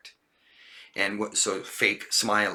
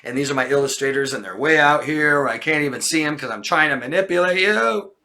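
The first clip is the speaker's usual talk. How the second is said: louder, higher, hurried over-explaining; manipulative storytelling; 5 semitones higher, 9 dB louder than usual. Acted. Teacher playing manipulative husband to wife, over-explaining. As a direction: gush out an elaborate cover story. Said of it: "I'm being manipulative, and I'm saying".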